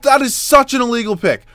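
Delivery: loud, high-pitched complaint; outraged protest. Real, not acted. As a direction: yell it at the referee. Protesting a foul in game.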